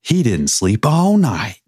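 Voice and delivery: put-on slow, drawling Scottish-turned-country accent; old country guy with botched Scottish accent. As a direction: drawl it like a Scottish cowboy in a Western. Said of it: "Scottish redneck".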